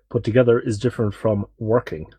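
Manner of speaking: ordinary talk, nothing marked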